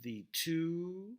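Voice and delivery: "The two" with light "The", high loud "two"; stress on second word. Shown as a demonstration. Lecturer scanning an unstressed-stressed beat, punching two. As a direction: punch and lift "two" after "The". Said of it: "this is stressed".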